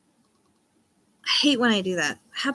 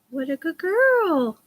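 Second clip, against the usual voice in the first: high, slow coo at the bird; sing-song praise for a good bird; 8 semitones higher, slower than usual. Real, not acted. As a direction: coo it like praising a pet parrot. Cooing to her pet bird.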